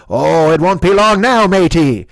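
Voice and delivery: hammy pirate talk. Acted. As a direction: talk it like an old sea pirate. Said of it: "Why are you talking like a pirate now?".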